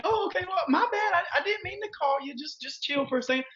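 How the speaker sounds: high, upbeat mock-friendly phone voice, then settling; brightly defuse the angry lead; cheery phone pivot. Agent brightly defusing an angry lead on a cold call, high cheery voice. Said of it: "phone call by saying".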